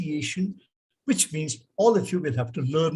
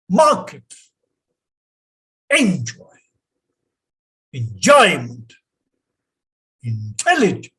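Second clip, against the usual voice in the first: each word with its stressed part pushed louder; stressed word list; 7 dB louder than usual. Shown as a demonstration. Teacher to class: model each word's stress, loud and clear. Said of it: "how do we pronounce these words? Listen".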